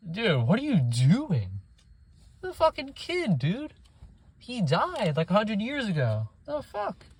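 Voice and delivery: stunned by the tastelessness; appalled disbelief. Real, not acted. Ranting about the dead actor joke, appalled disbelief. Outraged at Peter Pan joke.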